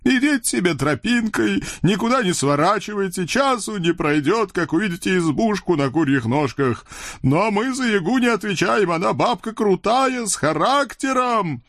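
high, cartoon devil voice scolding his friend; a chubby devil needling his buddy; devil Khryak. Толстый чёрт объясняет путь. (высокий мультяшный чёрт)

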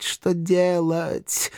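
high, very slow stagey lament; hammed-up drawn-out musing; theatrical pondering. A narrator's reading. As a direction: stretch it out in mock helplessness. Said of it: "театрально задумался Эшли".

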